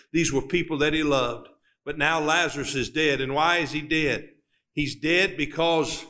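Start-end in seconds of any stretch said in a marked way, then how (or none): none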